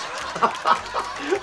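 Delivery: breathy, voiceless laugh; light burst of laughter; amused laugh. Real, not acted. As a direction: laugh at your own retelling. Laughing at the retold scene.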